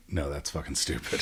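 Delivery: dismissive. Retold or imitated. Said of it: "the actress was like".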